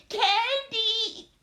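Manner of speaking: high, loud call; screamed name. Acted. Trapped girl to the leader above, high scream. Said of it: "I scream".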